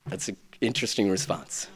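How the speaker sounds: soft, low, quick deadpan; dry aside. Real, not acted. Pastor commenting to listeners, soft deadpan. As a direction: undercut it drily.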